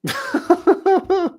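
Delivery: a real, high laugh at the joke; big loud laugh. Real, not acted. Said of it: "laughs at joke".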